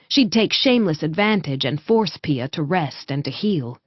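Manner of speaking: usual speaking voice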